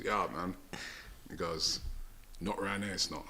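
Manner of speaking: very low, hushed, shady reply; mimicked street kid. Kid telling a driver parking isn't free, low and shady. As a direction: imitate a dodgy youth keeping his voice right down.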